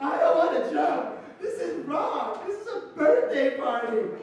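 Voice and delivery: loud, high mock wail; bawling. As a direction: wail it like a terrified kid in tears. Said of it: "he's bawling, he's like".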